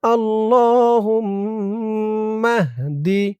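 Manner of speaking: drawn-out, melodic dua chanting; slow Quranic-style recitation of a prayer; slow Arabic recitation. Shown as a demonstration. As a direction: recite each syllable long and lilting.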